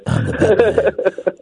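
slow, gruff superhero impression; gruff Batman voice. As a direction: growl it like the movie hero, drawn out. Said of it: "a gruff voice".

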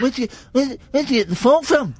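high, squeaky mock-indignant question; imitating co-host. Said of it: "and you went".

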